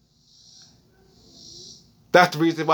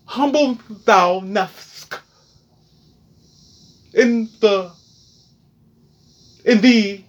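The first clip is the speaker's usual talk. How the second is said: mock King's English; much slower than usual. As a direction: drag it out like a mock preacher of thee and thou. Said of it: "Let me pronounce in the King's English".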